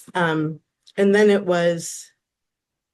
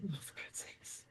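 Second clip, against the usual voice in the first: hushed, lower grumble, starting in a whisper; muttered exasperation; 3 semitones lower, faster than usual. Retold or imitated. Debate partner sighing over her teammate's mess, muttered exasperation. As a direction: imitate a partner grumbling under her breath.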